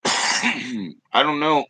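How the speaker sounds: slow words through a coughing fit; struggling to speak while coughing. Real, not acted. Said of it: "choking up in everybody's face".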